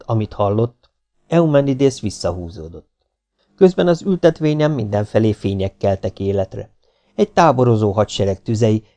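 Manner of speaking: plain reading voice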